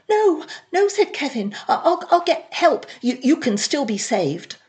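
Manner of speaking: high, loud alarmed pleading; panicked. Acted. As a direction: cry out in panic to save her. Kevin panics at overdose.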